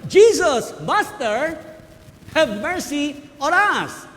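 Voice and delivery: high, loud plea, slow at the end; crying out. Retold or imitated. Ten lepers begging Jesus from afar, loud pleading cry. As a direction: cry out for mercy from a distance. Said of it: "Crying out".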